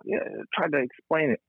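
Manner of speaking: normal talking voice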